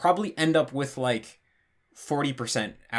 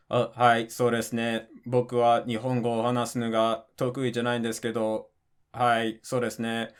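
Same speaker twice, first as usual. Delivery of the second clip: "hai, so desu ne" droned on one pitch; robot flat voice; 3 semitones lower than usual. Shown as a demonstration. Showing how literally flat Japanese would sound, robotic monotone on "hai, so desu ne". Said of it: "You would sound like a robot".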